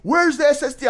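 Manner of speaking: high-pitched mock-angry demand; imitating angry customer. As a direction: act out an irate buyer demanding answers. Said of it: "So he's cussing me out".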